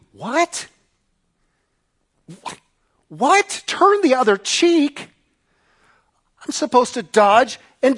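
high-pitched, baffled protest; incredulous. Acted. Peter balks at turning the other cheek, incredulous. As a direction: act out total disbelief, pitch jumping up. Acts Peter balking at Jesus' teaching.

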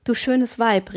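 ordinary delivery

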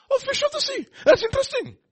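high, loud mock surprise. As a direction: gasp at it in high mock wonder.

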